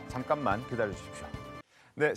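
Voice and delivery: ordinary talk, nothing marked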